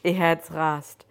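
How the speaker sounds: ordinary delivery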